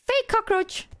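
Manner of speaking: high deadpan prank announcement; silly high announcement. Retold or imitated. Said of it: "Even though I said".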